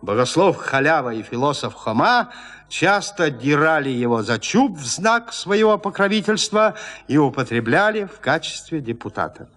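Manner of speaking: plain reading voice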